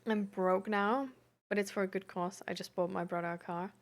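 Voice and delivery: usual speaking voice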